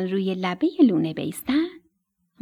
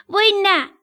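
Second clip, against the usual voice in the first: higher, louder frightened cry; shouting; 9 semitones higher, 5 dB louder than usual. Acted. Young stork scared of being beaten, shouting. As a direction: yelp it out in alarm. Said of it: "لک لک داد زد".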